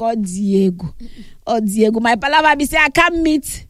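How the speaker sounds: usual speaking voice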